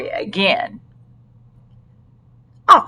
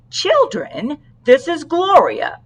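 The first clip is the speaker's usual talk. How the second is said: higher, bright announcing call; 7 semitones higher, slower than usual. Acted. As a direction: present the dog to the class. Officer introducing dog to children.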